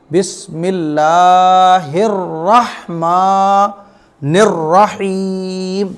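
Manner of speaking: very slow chant with heavy rolled r in रहमानिर; correct tajweed recitation. Shown as a demonstration. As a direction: stretch long vowels, hold the final Rahim. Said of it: "आपको क्या पढ़ना है?". (धीमी तिलावत, रहमानिर में रा मोटा)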